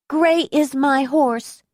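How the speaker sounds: put-on high, slow little child voice; a small child saying it proudly.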